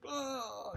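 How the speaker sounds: a sudden high, breathy little gasp as he collapses; a short, hammed-up gasp of shock; mock dying gasp. Nabal collapsing at the news, mock gasp.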